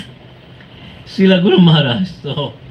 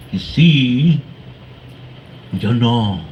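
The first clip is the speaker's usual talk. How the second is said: imitating Bhadacharya Prabhu; 4 semitones lower, slower than usual. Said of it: "He talks like this".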